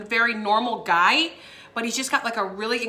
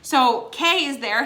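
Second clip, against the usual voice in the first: ordinary talk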